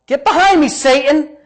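high, loud acted reprimand; stern rebuke. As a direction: rebuke him forcefully from the pulpit. Re-enacts Jesus rebuking Peter.

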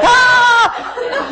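high, sustained mock scream; playful high-pitched imitation of screaming; imitated child's scream. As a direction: squeal out a long "ah" like a scared child. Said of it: "just yelling and screaming like".

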